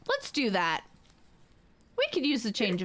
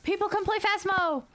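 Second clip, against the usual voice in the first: calling out; 9 semitones higher than usual. Real, not acted. Calling friends to join game.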